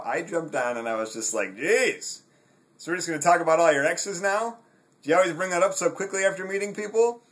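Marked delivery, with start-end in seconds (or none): 1.6-7.2 higher, louder and fast, dripping with sarcasm; heavy sarcasm. Retold or imitated. Replying to her dating message, thick sarcastic jab. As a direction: pile on every ounce of sarcasm. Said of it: "Meant to be with as much sarcasm as you can pile on it".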